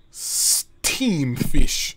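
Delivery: lightly voiced old Jamaican man.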